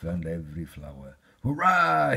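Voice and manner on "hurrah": high, loud cheer; excited shout. Acted. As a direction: shout it with joy. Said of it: "he shouted".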